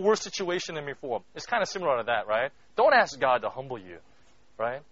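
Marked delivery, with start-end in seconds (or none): none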